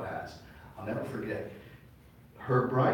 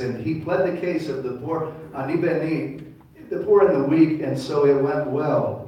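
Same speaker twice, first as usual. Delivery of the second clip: emphatic reading; 4 semitones higher, 9 dB louder than usual. Shown as a demonstration. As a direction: preach the verse out hard. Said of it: "the King James says it a certain way".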